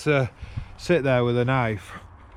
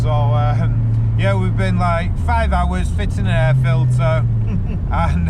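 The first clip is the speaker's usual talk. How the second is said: loud and higher, over engine noise; raised voice; 3 semitones higher, 7 dB louder than usual. Real, not acted. Driver to viewers on a road test, raised voice over the engine.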